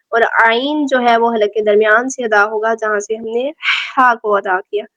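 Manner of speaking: ordinary delivery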